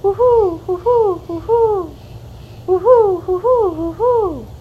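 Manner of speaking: high, loud hooting owl cry; owl call. Acted. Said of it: "And then Pa called".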